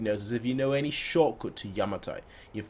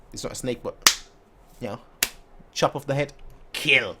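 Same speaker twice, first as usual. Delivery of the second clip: ordinary delivery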